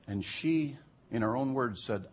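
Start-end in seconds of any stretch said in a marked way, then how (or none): none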